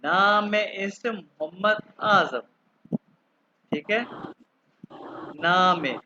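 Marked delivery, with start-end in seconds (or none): 0.1-2.4 slow, spaced mein Name ist; slow demo phrase. Shown as a demonstration. Teacher modelling the formal German self-introduction. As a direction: say it slowly so learners can follow. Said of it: "सबसे अच्छा तरीका नाम बताने का".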